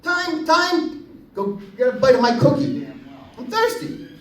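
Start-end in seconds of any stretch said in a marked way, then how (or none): 0.0-1.1 put-on high, eager little-boy call; a boy calling timeout to his coach, high and eager; kid calling time. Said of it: "kid calls timeout again". 3.4-4.1 put-on high, soft whiny little-boy voice; a boy whining to his dad, high and soft; whiny kid.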